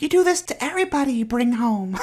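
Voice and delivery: high squeaky voice for the dog. Retold or imitated. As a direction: voice the dog as a tiny high critic. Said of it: "Tanny's like".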